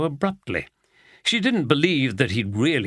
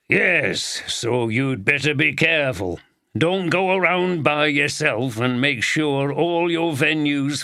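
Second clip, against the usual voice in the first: gruff Yorkshire voice; 2 semitones higher than usual. Acted. Walker warning Oldroyd.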